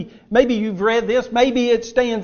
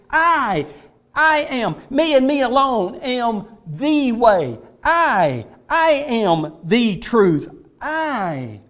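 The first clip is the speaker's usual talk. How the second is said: each "I" pitched up, drawn out and set apart; solemn declaration, long falling "I" and weighty "am"; emphatic I; 4 semitones higher, 3 dB louder, slower than usual. Acted. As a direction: hammer "I", slowly, with pauses between claims. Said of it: "But He was being emphatic".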